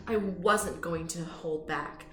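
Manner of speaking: ordinary delivery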